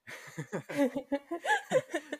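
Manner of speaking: bright burst of laughter; high laugh. Real, not acted. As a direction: laugh at your own slip. Laughs at own flubbed lines.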